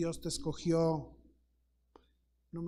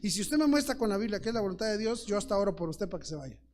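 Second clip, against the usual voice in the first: louder and quicker, pressing the point; forceful pastor's challenge; 3 semitones higher, 7 dB louder, faster than usual. Retold or imitated. A pastor challenges the preacher to prove it from Scripture, louder and faster. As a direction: lay down the challenge with rising force.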